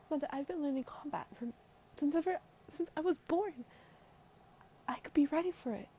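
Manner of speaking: high, loud indignant daughter voice; protesting daughter. Acted. Daughter argues to join mission.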